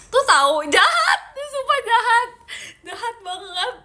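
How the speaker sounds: playful fake hurt, shrieking with laughter; laughing mock outrage. Real, not acted. Mock outrage at viewer's joke. (protes pura-pura sambil tertawa melengking)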